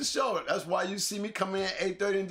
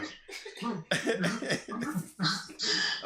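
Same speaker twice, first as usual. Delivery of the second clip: laughing; 3 dB quieter than usual. Real, not acted. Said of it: "I'm laughing so damn much".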